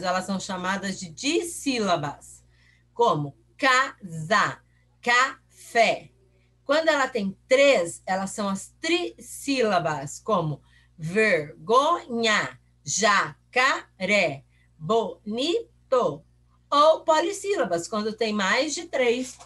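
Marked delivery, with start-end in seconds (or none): 3.6-6.1 CASA and CAFÉ slowly split, ca... sa, ca... fé; slow two-beat split of CASA and CAFÉ; syllable by syllable. Shown as a demonstration. Teacher splitting example words into syllables. 11.0-16.2 VERGONHA, JACARÉ, BONITO in slow separate beats; slow three-piece split of VERGONHA, JACARÉ, BONITO; syllable by syllable. Shown as a demonstration. Teacher splitting three-syllable examples.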